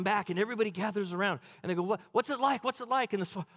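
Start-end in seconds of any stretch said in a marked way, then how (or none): 1.8-3.1 eager crowd. Acted. People eagerly asking the spies.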